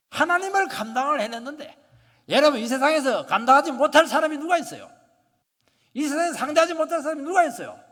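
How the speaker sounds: loud, high, ringing sermon delivery; preaching at full force. Acted. Pastor urging the congregation to open their hearts, preaching loudly. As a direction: proclaim it to the whole hall.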